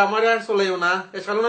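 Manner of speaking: ordinary talk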